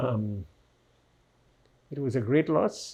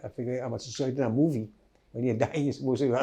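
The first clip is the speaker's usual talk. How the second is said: ordinary talk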